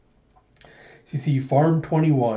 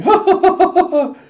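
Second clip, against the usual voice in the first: loud, very high "ha ha" screech; hammed-up high-pitched screaming; 10 dB louder than usual. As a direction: shriek it in staccato bursts.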